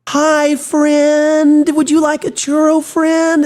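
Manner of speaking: very high, sugary greeting voice; overly sweet cast member. Retold or imitated. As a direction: gush like a children's show host. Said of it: "It was like".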